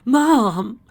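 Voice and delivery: high, loud wailed cry for a mother; acted tearful shout; sobbing cry. A narrator's reading. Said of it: "she sobbed".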